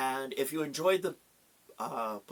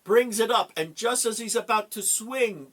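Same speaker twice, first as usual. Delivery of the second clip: dramatic build; 6 semitones higher, 5 dB louder than usual. A narrator's reading. Narrating the raised axe.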